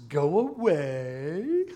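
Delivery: slow drawn-out mimicry, then a cackle; Gollum's mocking laugh. Preacher as Gollum laughing at Smeagol's plea, mocking. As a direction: echo it mockingly, stretched and loud, then laugh. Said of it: "Gollum laughs".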